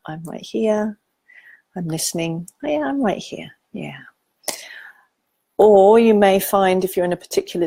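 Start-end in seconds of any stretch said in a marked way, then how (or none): 0.1-4.0 softer, gentle comforting murmur; tender soft reassurance. Shown as a demonstration. Parent comforting an upset child, soft and tender. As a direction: soothe a child, softly. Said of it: "I like to keep, keep it pretty simple".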